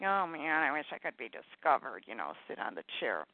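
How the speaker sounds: low, wistful aspiring starlet; Sophie the Starlet. Acted. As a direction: sink down low into dreamy self-pity. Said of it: "I have Sophie the Starlet".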